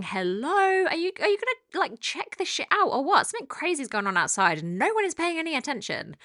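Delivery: high-pitched, mock-indignant dog demanding attention; alarmed barking dog. Dog complaining to owner about ignored alert, mock alarm. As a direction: voice an exasperated dog raising the alarm, pitched well up. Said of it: "they're, like, sat there essentially saying".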